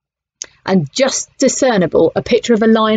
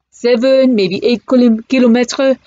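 put-on French accent; 5 semitones higher, 4 dB louder than usual. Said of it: "in broken English".

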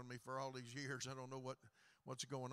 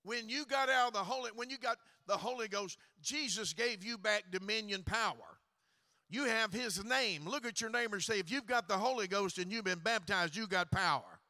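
rising preaching; 5 semitones higher, 8 dB louder than usual. Acted. Building sermon call to neighbor.

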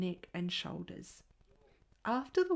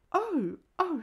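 high, loud scandalised oh, oh; shocked cry; 8 semitones higher, 5 dB louder than usual. A narrator's reading. A scandalised lady cries out at the porter's crude word.

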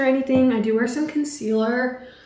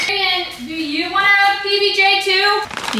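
higher and slower, called across the house; calling out; 7 semitones higher, 4 dB louder, slower than usual. Real, not acted. Wife calling to Darian in another room, called out. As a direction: call to someone out of sight.

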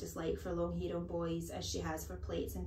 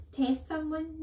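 put-on higher, louder boy's challenge; daring schoolboy; 9 semitones higher, 6 dB louder than usual. Pupil taking an awful chance with headmistress, plucky.

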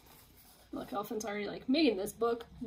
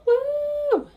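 much higher, louder whoop; cheering woo; 9 dB louder than usual. Acted. Piggy celebrating her throw, cheering. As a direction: whoop like a winner.

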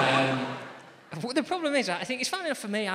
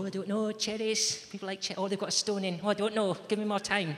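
put-on higher-pitched dithering girl; nervous girl; 7 semitones higher than usual. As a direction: fluster like a contestant put on the spot. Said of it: "perfectly normal girl".

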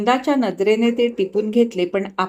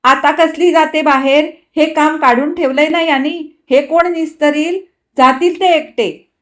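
higher, louder fed-up complaint; annoyed and resigned; 7 semitones higher, 8 dB louder than usual. A narrator's reading. Wife complaining of the mess her husband made, fed-up. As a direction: vent your irritation, then give up. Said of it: "त्रासिकपणे काहीशा हताशपणे म्हटले".

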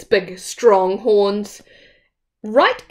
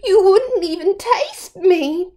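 high little goat voice; pleading little goat; 9 semitones higher than usual. Acted. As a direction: talk the troll out of eating you.

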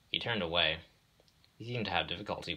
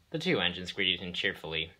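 ordinary delivery, nothing marked

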